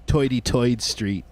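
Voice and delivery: imitating a subway guy's Brooklyn "Toity Toid Street"; "Toity Toid" with oy for the ir sound, New York style; old New York accent. Quoting Bill Burr's subway guy in a light New York accent. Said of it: "Bill Burr would do a funny bit where he said the subway guy would say".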